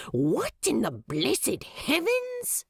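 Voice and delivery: Crowley lightly voiced, indignant at the sky; swears loudly. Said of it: "Crawley swears loudly".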